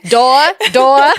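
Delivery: mimicked small child. Mother mimics her door-obsessed little boy, childlike and loud. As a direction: imitate a little kid, punched out loud.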